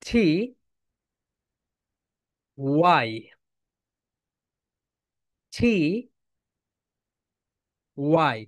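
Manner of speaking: slow letter-by-letter T then Y; spelled-out letters. Shown as a demonstration. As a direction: call out each letter for the class to repeat. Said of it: "সবাই বলো". (ধীরে ধীরে টি আর Y আলাদা করে বলা)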